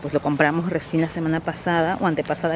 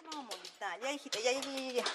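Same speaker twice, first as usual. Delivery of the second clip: soft calming hush; 6 semitones higher, 19 dB quieter, faster than usual. Real, not acted. Calming the dog softly.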